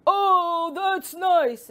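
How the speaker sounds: high, loud frog shout; big frog voice. Acted. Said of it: "shouted in its big voice".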